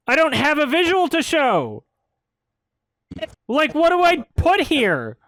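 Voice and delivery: real frustrated shouting at the film; exasperated rant. Real, not acted. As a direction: vent like nothing works. Ranting about the missing visual.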